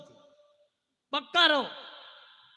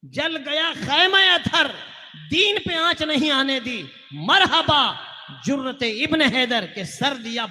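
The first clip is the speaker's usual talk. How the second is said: loud, ringing, raised recitation; declaimed verse; 2 semitones higher, 9 dB louder than usual. Acted. Preacher praising the martyrs of Karbala, ringing declamation. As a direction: proclaim each phrase with full force.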